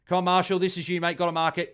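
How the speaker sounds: high, loud urging cheer; will the player to take the mark; excited urging. Real, not acted. Streamer urging Marshall to mark, real excited cheer.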